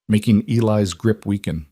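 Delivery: ordinary delivery, nothing marked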